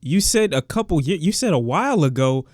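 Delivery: high, rising disbelief at the lyric; wait, what changed; incredulous outburst. Real, not acted. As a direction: call him out, stunned. Arguing back at the song lyrics.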